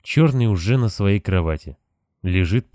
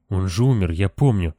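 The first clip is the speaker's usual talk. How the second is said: ordinary delivery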